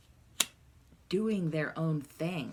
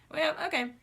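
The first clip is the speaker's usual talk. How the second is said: much higher, louder mock shrug; hands-up resignation; 12 semitones higher, 6 dB louder than usual. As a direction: toss it off like throwing up your hands. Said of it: "throwing your hands up".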